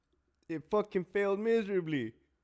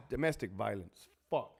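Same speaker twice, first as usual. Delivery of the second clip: ordinary delivery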